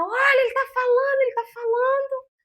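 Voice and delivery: high tearful shout of joy; sobbing, shouting the news; crying out in joy. Retold or imitated. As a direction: cry it out, overwhelmed with happiness. Nurses rush in crying, shouting.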